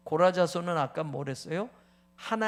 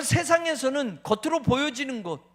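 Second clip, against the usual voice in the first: usual speaking voice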